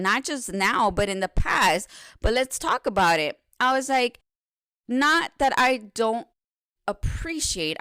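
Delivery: a tough-love wake-up call to her own reflection; fired-up pep talk to herself. Retold or imitated. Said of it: "I said".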